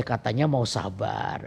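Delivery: low, slow gentle chiding; God's reminder. Retold or imitated. God reminding the preacher as anger rises, low slow reminder. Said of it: "tiba-tiba Tuhan bil".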